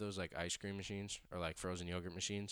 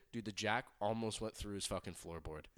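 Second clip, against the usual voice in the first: ordinary talk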